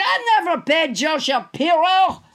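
ordinary talk, nothing marked

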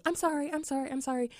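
repeated apologizing. Retold or imitated. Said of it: "me the whole time saying".

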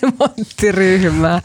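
drawn-out word through laughter; amused echo. Real, not acted. Panelist teases colleague over a mistaken party name, amused echo. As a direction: laugh while slowly repeating the name.

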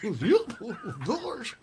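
laughing goofy cartoon voice; giggly Goofy. Shown as a demonstration. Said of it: "where I'm goofy".

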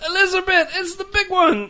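high, strained mock cry to the heavens; Red Fox catchphrase impression; Fred Sanford. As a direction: clutch your heart and call out. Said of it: "his big catch line was".

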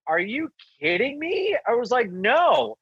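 high, disbelieving reply; incredulous refusal. Retold or imitated. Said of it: "And I'm like".

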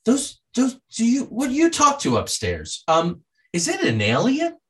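high, halting questioning voice; retold hesitant question, very high; grandmother asking. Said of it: "And she says".

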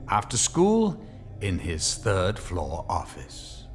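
ordinary delivery

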